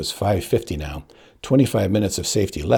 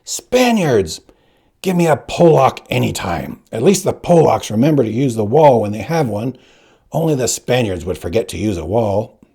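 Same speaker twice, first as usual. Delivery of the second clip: shouting teacher; 3 semitones higher, 5 dB louder than usual. Acted. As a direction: berate the pupil like a furious old teacher. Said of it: "McPherson was screaming again".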